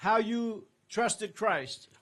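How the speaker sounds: ordinary talk, nothing marked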